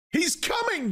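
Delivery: very high-pitched excited shout. A narrator's reading. Woman announcing the approaching being, shouting high. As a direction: shout it out at the top of the range. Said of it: "shouted Sarojini Engels".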